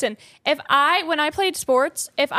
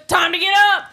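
louder, barked call; drill sergeant wake-up; 3 semitones higher, 4 dB louder, faster than usual. Retold or imitated. Wife mimics husband's army-style wake-up call. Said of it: "he yanks the blinds open".

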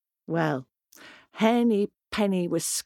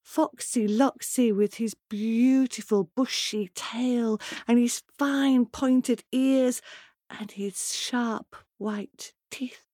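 plain reading voice

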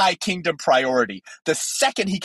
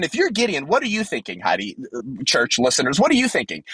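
ordinary talk

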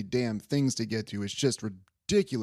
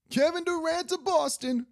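very high-pitched mock-pundit proclamation; hammed-up prediction held high all through; Stephen A. Smith. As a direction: imitate a pundit at peak insistence. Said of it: "that's how he goes".

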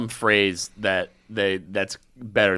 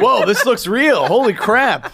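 high, loud, slow gawking awe; mock amazement; 11 semitones higher, 7 dB louder, slower than usual. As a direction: act dazzled by a fake. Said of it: "It's like".

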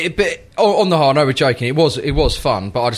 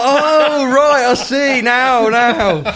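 much higher, slow, drawn-out "oh, right, I see now"; slow dawning realisation; 10 semitones higher, 4 dB louder, much slower than usual. Retold or imitated. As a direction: realise mid-sentence that your mate has gone, stretching it out. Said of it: "I was like".

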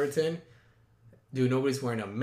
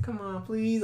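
higher, cutesy little plea; cute pleading; 6 semitones higher, slower than usual. Retold or imitated. Date showing how he asked for kiss, cute pleading. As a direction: beg sweetly and playfully. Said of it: "I was, like, very cute about it".

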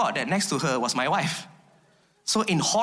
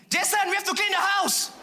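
panicked wife shouting orders; yelling wife; 12 semitones higher than usual. Retold or imitated.

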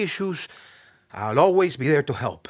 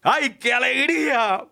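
joyful cry; 8 semitones higher than usual. Acted. Celebrating success.

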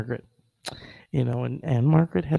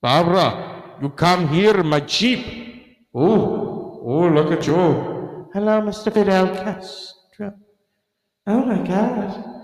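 put-on Castro accent; 6 semitones higher, 3 dB louder than usual. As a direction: play Castro with a thick accent. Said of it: "'cause Castro was like".

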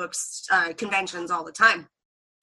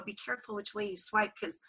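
much softer, with a laugh in it; a cheeky aside to the celebrity; 12 dB quieter than usual. Retold or imitated. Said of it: "And I go".

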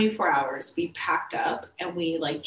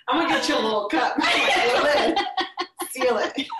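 laughing joke; 6 semitones higher than usual. Real, not acted. Listener joking suggestion.

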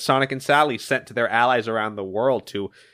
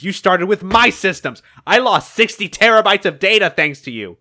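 raised, angry mock scream; screaming villain; 6 semitones higher, 5 dB louder than usual. Eggman rails at Phage over his lost data, screaming. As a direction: push it higher and louder in outrage. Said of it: "then Eggman screams".

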